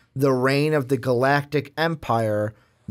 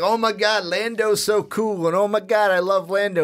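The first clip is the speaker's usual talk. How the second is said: higher-pitched imitation of adoring fans; mock fan gushing; 6 semitones higher than usual. Host retelling Mark mimicking Lando fans, mock gushing. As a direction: gush like a fan, lightly mocking. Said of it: "everyone's like".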